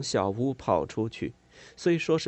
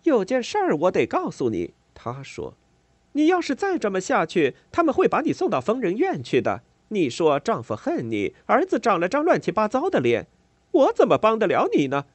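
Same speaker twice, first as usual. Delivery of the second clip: scornful scolding; 10 semitones higher, 6 dB louder than usual. A narrator's reading. Neighbour scolding the mother.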